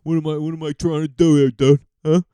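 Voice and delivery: loud, macho bro voice mocking dudes. Mocking dudes who refuse to wear pink, as a loud bro. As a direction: ham up a dismissive bro who rises to a shout. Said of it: "how dudes are like".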